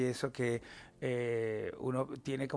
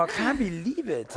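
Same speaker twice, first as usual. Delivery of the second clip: posh British English; 5 semitones higher, slower than usual. Retold or imitated. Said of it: "hablando súper british".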